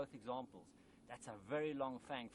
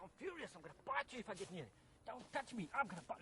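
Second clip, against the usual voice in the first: mock-furious snake talking, much higher; angry snake voice; 9 semitones higher than usual. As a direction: voice the snake as a furious little creature. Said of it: "Oh, he says".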